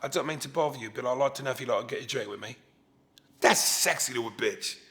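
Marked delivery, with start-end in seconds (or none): 0.0-2.5 a hint of a smooth British accent, quick and quiet. To a woman, a guy offering a drink in a light British accent. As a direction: murmur it fast in a faint British accent. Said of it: "when you hear a guy go".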